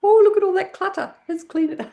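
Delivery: very high, playful mock shock; hammed-up playful astonishment; pretend amazement. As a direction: exclaim high at an imaginary mess. Marvelling at imaginary brain clutter.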